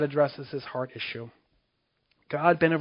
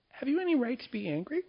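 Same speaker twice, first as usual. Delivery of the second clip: God's gentle question; 10 semitones higher, 4 dB quieter than usual. Acted. God questions angry Jonah.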